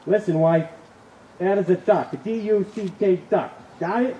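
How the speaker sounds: loud, high, spelling it out angrily; angry husband. Acted. Husband spells out duck to wife, angry and loud. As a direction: bark it letter by letter. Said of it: "he said angrily".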